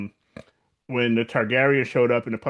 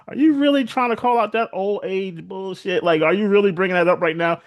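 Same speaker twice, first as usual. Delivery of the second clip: high, exasperated mock scolding of a reckless brother; incredulous scolding; 7 semitones higher than usual. Other Targaryens scold Aerion, high and incredulous. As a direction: voice it up high, disbelieving and fed up. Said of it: "the other Targaryens are like".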